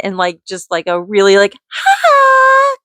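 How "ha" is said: very high, loud, silly squealed "ha"; high-pitched goofy "ha". Shown as a demonstration. Mom messing with her kids, in a goofy high squeal. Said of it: "kind of voice".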